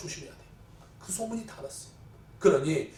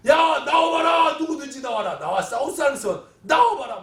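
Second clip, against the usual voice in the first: shouted challenge; 5 semitones higher, 5 dB louder than usual. Acted. Goliath challenging the enemy army, shouted taunt. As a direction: call out any challenger at full voice. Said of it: "골리앗이 와서 막 소리치는 거예요".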